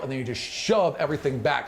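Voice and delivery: ordinary delivery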